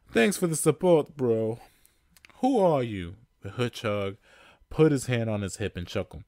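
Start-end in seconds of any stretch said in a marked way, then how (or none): 0.1-3.2 higher, louder dry sarcasm; sarcastic thanks. Acted. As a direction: needle your brother. Sarcastic Mario to Luigi.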